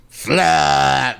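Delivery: long drawn-out mock retch; comic throwing-up sound effect; imitated vomit splat. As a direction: spew it out in one long noise. Imitates violent vomiting onomatopoeically.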